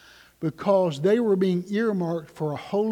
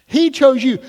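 much louder, higher, driving it home; preaching shout; 5 semitones higher, 10 dB louder than usual. Acted. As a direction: proclaim it to the congregation. Preacher repeats at full force.

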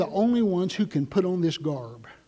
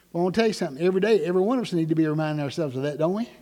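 ordinary talk

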